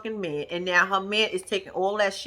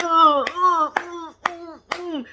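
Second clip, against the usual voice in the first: awkward stammer; 6 semitones higher, much slower than usual. Retold or imitated. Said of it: "he was like".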